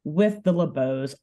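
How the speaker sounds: ordinary delivery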